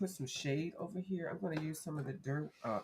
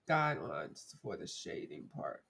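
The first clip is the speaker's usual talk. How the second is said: ordinary delivery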